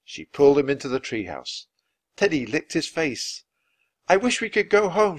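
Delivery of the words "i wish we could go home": high, loud urgent wish; shouting. A narrator's reading. Annie wishes them home from the sinking ship, shouting. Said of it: "shouted Annie".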